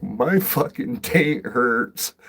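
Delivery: loud, high, slow exaggerated complaint; mock pained whine. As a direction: whine it out like it really hurts. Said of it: "I'm like".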